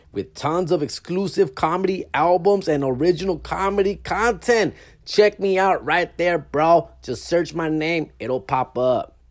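upbeat promo voice selling the album; ad read. Acted. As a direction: announce it like a radio spot.

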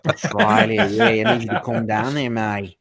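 hammed-up Aussie croc wrangler; mock Australian coaxing a beast; Australian accent. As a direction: overdo a broad Australian accent, playing along. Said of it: "Your Australian accent is so good".

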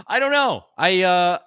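high, loud words through laughter; laughing. Real, not acted. Laughs at own shirtless story.

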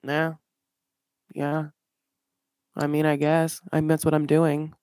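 low, soft, unenthused shrug of a reply; reluctant mumble. Retold or imitated. Mocking Eric's grudging answer to Puppy, low and soft. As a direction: sound like a kid dragged to the dentist.

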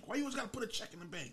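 high, fast exasperated mock whine; mocking question. Complaining about the old partner's bank habit, a high fast mocking whine. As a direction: throw the question at him, fed up.

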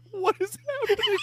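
high, fast mock-puzzled kid voice; imitating kids. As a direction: squeak it like a confused child. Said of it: "my kids outside the room going".

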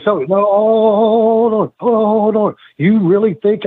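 high, slow, sneering mock of a rival; mocking imitation. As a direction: stretch "hold on" up high like a whiny challenger. Mocking AJ's challenge.

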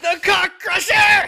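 very high, full-volume yell; drawn-out, over-the-top shout; gleeful battle-cry shout. Pretend. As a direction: bellow it slowly like a signature move. Player echoes the battle cry.

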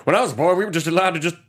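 mock the pious salesman, hamming it up; imitating the Bible salesman. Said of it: "My favorite is this where".

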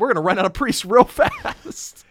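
high, loud comic fretting; mock panic. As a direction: escalate into hammed-up worry, breaking into a laugh. Moses panicking, played for laughs.

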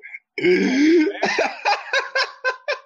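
high, loud cackling laugh; screaming laugh. Real, not acted. Cracking up at a friend's roast, howling laugh. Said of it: "Now I'm screaming".

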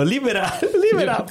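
high, mock medical warning; a hint of emergency-room drama; mock defibrillator call. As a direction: call it like a TV medic, half laughing. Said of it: "che fanno".

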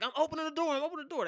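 high, hurried pleading call; retold panic. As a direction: beg them to stop, voice up high. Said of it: "I'm panicked".

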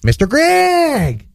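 high, very drawn-out name call; dad's Mister greeting. Retold or imitated. Son imitating dad addressing everybody, high drawn-out call. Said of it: "he calls everybody by Mister".

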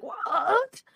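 high, disbelieving what?; shocked what. Retold or imitated. Reading the trade news, a shocked high "What?".

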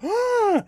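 high startled shriek; high scream. Retold or imitated. Girl shrieking at the sight of the pit. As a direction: shriek in sudden fright.

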